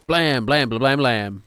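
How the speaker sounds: punchy, loud gunshot noises; mock gunfire. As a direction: fire off rapid vocal gunshots. Imitating rapid gunfire.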